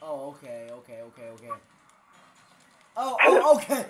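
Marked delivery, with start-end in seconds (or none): 3.0-3.8 startled. Real, not acted. Startled gamer.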